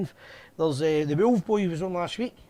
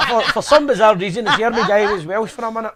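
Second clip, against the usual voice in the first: laughing through the words, louder; 3 semitones higher, 7 dB louder than usual. Real, not acted. Host laughing at his own botched accent, amused and laughing. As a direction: crack up at your own mistake.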